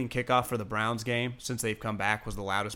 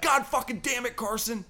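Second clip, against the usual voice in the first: much higher exasperated mock curse; imitating angry mom; 13 semitones higher than usual. Host imitating the Eagles mom cursing at Carson Wentz, mock exasperation. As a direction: spit it out like a fed-up fan mom. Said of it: "She's like".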